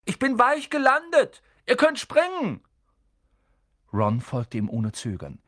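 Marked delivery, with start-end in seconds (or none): 0.0-2.6 loud high call upward; boy calling up to friends through a hatch, loud and high. Acted. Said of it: "rief er nach oben".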